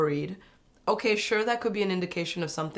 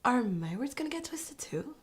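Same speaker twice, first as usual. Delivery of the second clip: high, soft mock-worried voice; airy, high imitation of fretting; imitating Cassie; 7 semitones higher, 4 dB quieter than usual. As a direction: quote a contestant in a small high voice. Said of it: "Cassie said".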